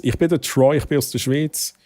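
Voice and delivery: "ich bin der Troy, ich bin aus der Schweiz" in Swiss German, "Schweiz" as Schwiiz; Swiss German dialect. Shown as a demonstration. Troy introduces himself to the raiders in Swiss German dialect. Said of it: "ich mach kurz auf Hochdeutsch".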